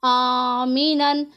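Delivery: very slow, drawn-out recitation of Bala दन आमीनन; Quranic recitation. Shown as a demonstration. Teacher reciting the tanween example slowly for her students. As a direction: chant Bala दन आमीनन slowly with a clear तनवीन. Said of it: "गुन्ना नहीं करना".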